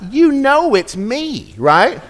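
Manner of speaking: high-pitched mock frustration at Siri; exasperated plea. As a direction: beg the phone, fed up and incredulous. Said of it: "I'm like".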